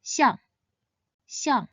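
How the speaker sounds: "xiang" starting high and falling sharply; Mandarin fourth tone. Shown as a demonstration. Teacher models the word for elephant, "xiang" in a falling fourth tone. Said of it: "It should be pronounced in the fourth tone".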